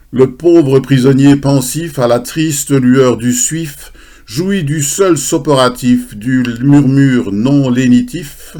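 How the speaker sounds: loud, measured verse declamation; poem recitation. Acted. Presenter recites a poem about the château d'If, loud declamation. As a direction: declaim it to a hall, drawing out the rhymes.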